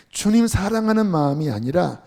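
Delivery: usual speaking voice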